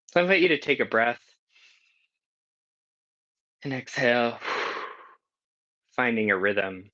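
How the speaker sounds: unhurried breath cue with an audible sigh; guided breathing. Acted. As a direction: breathe out with them as you guide.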